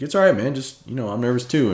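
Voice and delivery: high, loud teasing reassurance; mock comfort a nervous fan; reassuring celebrity. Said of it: "He's like".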